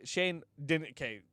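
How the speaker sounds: ordinary talk, nothing marked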